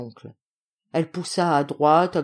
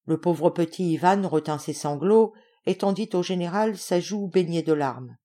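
ordinary delivery

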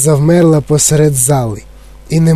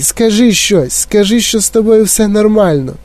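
higher, anxious begging; pleading; 6 semitones higher than usual. A narrator's reading. Mother needing her son's reassurance, pleading. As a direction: implore with the pitch raised. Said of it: "благала пані Т В".

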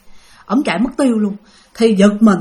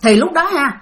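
ordinary delivery, nothing marked

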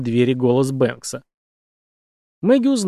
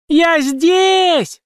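much higher, louder scream; frantic scream; 6 dB louder than usual. A narrator's reading. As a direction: scream with all your lungs. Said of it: "истошным голосом закричала Мэгги во всю силу лёгких".

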